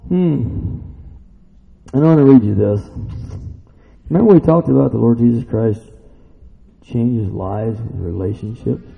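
normal talking voice

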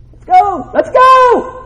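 high, loud rallying yell; hyped-up shout. Acted. Preacher arriving in the morning, pumped-up shouting. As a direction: holler it to fire up the room.

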